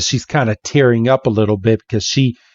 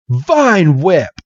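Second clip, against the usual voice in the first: higher, falling battle cry; attack call; 5 semitones higher than usual. Acted. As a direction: call out the move like a command. Trainer calls out an attack.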